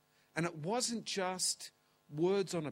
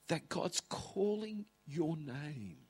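ordinary delivery

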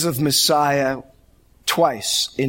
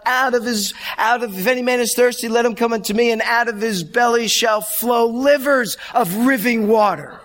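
raised, higher preacher's cry, stumbling over the words; preached yell; 9 semitones higher than usual. Retold or imitated. Student preacher yelling the verse in class, stumbling. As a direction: belt it out like a seminary student in full flow. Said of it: "in a loud voice yelled out".

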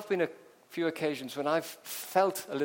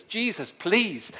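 pleading; 5 semitones higher, 6 dB louder, much slower than usual. Acted. Jairus begging Jesus again.

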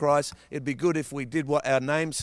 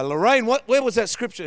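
higher, louder call of a preacher dad; imitating dad; 8 semitones higher, 6 dB louder than usual. Dad calling to Lorraine from the pulpit, higher, louder mock call. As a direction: call out mid-sermon to his wife. And he would go.

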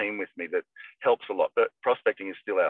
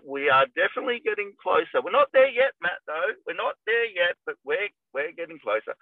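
put-on much higher, lilting old lady voice; 7 semitones higher than usual. Elderly client tells her agent the sale is near, high lilting voice. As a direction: coo it like a hopeful elderly client.

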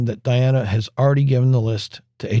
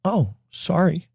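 mock sorry; 6 semitones higher, slower than usual. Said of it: "she says".

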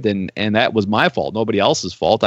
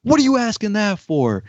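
a retold angry pastor, pitched much higher; upset retold pastor; 9 semitones higher than usual. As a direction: snap back like an offended pastor, half-acted. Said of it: "And he got so upset".

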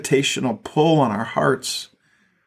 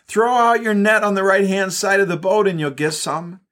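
higher, projected call; yelling back; 5 semitones higher than usual. Acted. Stranger on the shore to men in the boat, yelled back. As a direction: call instructions out across the water. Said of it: "The stranger yells back".

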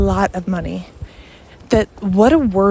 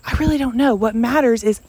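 normal talking voice

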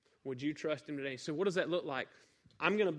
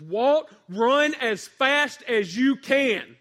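higher, much louder, very slow desperate call; urgent shout; 9 semitones higher, 14 dB louder, much slower than usual. Retold or imitated. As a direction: yell to save your son. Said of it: "I hear my dad say this".

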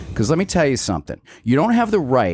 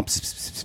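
whispered hissing pss sounds; gossip whispering; 13 dB quieter than usual. Retold or imitated. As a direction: hiss like gossiping neighbours. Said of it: "they're all".

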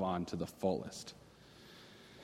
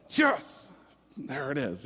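excited yes; 4 semitones higher, 3 dB louder, slower than usual. Retold or imitated. Excited about seeing a fire.

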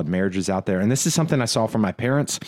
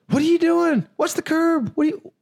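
much higher, shrill mock yelling at the driver; mock road rage. Passenger mocking his own road rage reaction, hammed-up angry. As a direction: snap at the driver in a hammed-up panic.

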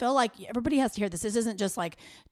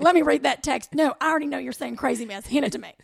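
put-on high loud teen girl bossing; grabby, giddy teenager demanding the phone; teen girl squeal; 5 semitones higher, 6 dB louder than usual. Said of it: "we are fourteen-year-old girls".

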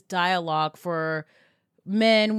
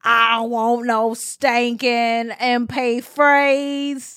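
mock [name]; 7 dB louder, much slower than usual. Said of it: "was like".

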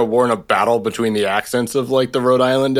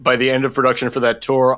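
ordinary talk

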